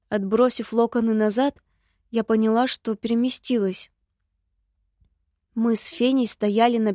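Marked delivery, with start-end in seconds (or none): none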